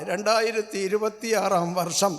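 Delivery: ordinary delivery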